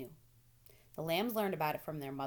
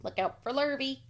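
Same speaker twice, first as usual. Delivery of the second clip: loud, higher warning call; cows calling out; 7 semitones higher, 7 dB louder than usual. A narrator's reading. Cows calling a warning to Wilbur, loud. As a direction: call it out across the yard. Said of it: "called the cows".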